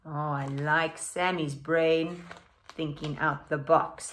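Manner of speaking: ordinary delivery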